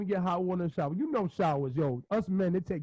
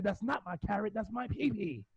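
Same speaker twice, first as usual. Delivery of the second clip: son's voice; 7 dB quieter than usual. Retold or imitated. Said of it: "he said".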